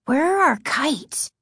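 high, eager question, slightly winded; a touch of breathlessness; out of breath. A narrator's reading. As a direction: ask as if just run up. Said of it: "he asked, out of breath".

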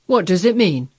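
loud, startled doctor; taken-aback, forceful question; alarmed demand. A narrator's reading. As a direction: demand an explanation, loudly.

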